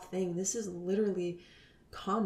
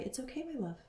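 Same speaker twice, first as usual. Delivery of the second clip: soft, higher, tender self-talk; soothing herself; 6 semitones higher, 6 dB quieter than usual. Retold or imitated. To herself when drifting, soft tender coaxing. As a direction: gently coax yourself back, like comforting a child. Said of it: "I would say".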